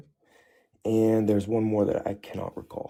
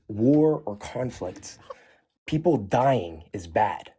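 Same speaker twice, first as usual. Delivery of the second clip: slow and deliberate; much slower than usual. Real, not acted. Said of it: "slow emphatic point".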